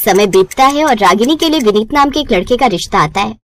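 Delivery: usual speaking voice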